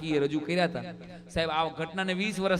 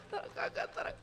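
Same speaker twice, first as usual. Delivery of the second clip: mock wailing; 11 dB quieter than usual. Widow wailing for her dead husband to the boy, hushed mock lament. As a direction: stretch the kaka into a hushed lament. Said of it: "રોતા રોતા કીધા".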